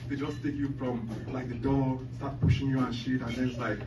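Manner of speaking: ordinary delivery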